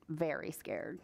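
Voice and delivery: ordinary delivery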